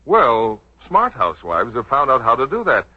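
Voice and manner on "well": ordinary delivery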